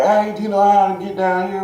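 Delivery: lightly voiced sketchy stranger calling out; creepy stranger voice. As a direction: imitate the man coaxing a kid over. Stranger luring a kid, retold.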